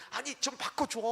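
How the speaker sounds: high-pitched, pleading mock demand; imitated insistent wife. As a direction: push back as the exasperated wife. Wife insists to secretary on phone. (높은 목소리로 조르는 아내 흉내)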